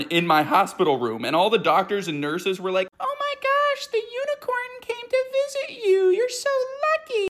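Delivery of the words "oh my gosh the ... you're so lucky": very high, cooing nurse voice. Retold or imitated. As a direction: coo at the child like a sugary nurse. Nurses greet kid with unicorn.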